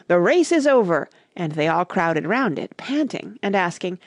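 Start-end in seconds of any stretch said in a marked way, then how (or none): 0.1-1.1 loud, higher sudden announcement; calling out. A narrator's reading. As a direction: call it out to the whole party. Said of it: "the Dodo suddenly called out".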